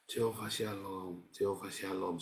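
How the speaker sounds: soft, slow whispered repetition; hushed calming chant; quiet murmured prayer. Retold or imitated. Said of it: "Saya bilang begitu".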